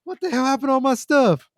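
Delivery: very high mock teenage squeal; Theo's squeaky voice. Narrator imitating Theo missing his stuff, squeaky. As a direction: imitate a pubescent boy squeaking in disbelief. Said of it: "he has that squeaky voice".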